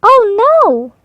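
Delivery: high, loud alarmed cry; panicked goose. A narrator's reading. The goose cries out in fright at the fox, high and loud. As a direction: squawk it in fright.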